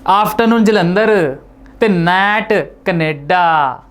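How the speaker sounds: Punjabi lilt, drawn-out last line; Punjabi accent. Shown as a demonstration. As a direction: show off a Punjabi twang. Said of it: "समझ आ गया होगा आपको।". (पंजाबी लहजे में)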